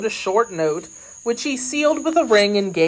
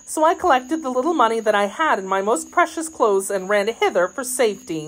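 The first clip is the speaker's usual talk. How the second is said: higher-pitched, hurried maid telling her story; frightened maid; 6 semitones higher than usual. Acted. Maid telling D'Artagnan why she fled, higher and agitated. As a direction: voice a young maid recounting her escape. Narrator voices maid Kitty.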